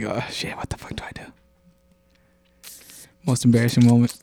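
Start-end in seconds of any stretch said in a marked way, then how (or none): 0.0-1.2 hushed, breathless whisper of panic; panicked whisper. Retold or imitated. A kid caught out in the cafeteria, whispering in panic. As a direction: whisper it like you're frozen in shock. Panicked inner thought, retold.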